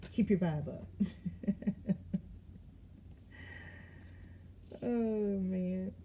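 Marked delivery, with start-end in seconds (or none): 0.9-4.8 airy helpless laughter; breathy laugh. Real, not acted. Cracking up at her own joke, laughing breathily.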